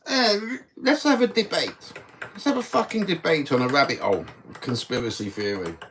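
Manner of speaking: high, nasal-free mocking squeak of a know-it-all; mock debater voice. Mocking conspiracy debaters in a high silly voice. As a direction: sneer at them in a squeaky mock voice.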